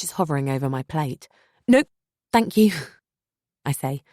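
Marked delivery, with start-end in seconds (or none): 1.7-2.7 quick, higher, louder refusal; too hasty. Acted. Narrator refusing a second helping, hasty and high. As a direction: blurt it out before the spoon drops. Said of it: "a bit too hastily".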